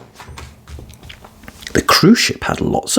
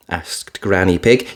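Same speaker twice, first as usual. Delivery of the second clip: ordinary delivery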